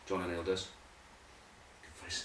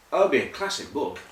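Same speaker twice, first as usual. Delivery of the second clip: ordinary delivery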